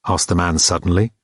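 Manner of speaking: ordinary delivery, nothing marked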